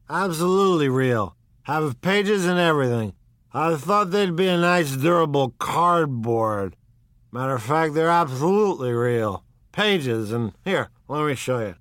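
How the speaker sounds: put-on high, loud, eager drunk know-it-all; a stout drunk bursting to share his discovery; tipsy owl-eyed man. A narrator's reading. Drunk guest tells Jordan the books are real, excited.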